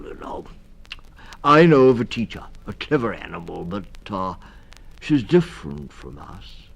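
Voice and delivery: put-on stammering old toad voice, kind; a dithering, kindly toad; kindly Mister Toad.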